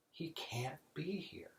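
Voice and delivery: slow conspiratorial whisper. Acted. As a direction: hush it like a secret verdict. Pope whispers his verdict.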